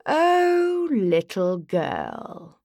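languid, elegant male elf; elegant elf sigh. A narrator's reading. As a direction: sigh it out with lordly grace. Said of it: "an elegant male voice".

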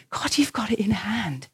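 a soft, breathy whisper of trust; hushed, trusting prayer. Retold or imitated. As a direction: breathe the words to God in a whisper.

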